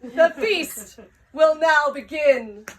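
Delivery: loud, high regal proclamation; slowing, ceremonious queen's address; Swamp Queen. Acted. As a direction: announce it grandly to adoring subjects. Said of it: "And she says".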